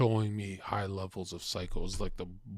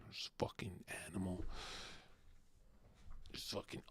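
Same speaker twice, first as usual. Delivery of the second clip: whispered; 3 semitones lower, 15 dB quieter than usual. Acted. Whispered build-up.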